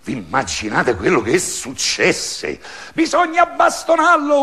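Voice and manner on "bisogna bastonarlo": high, loud mob shout; angry crowd yell. A narrator's reading. Crowd demanding the little man be beaten, loud high angry shout. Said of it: "urlò la folla".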